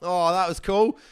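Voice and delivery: usual speaking voice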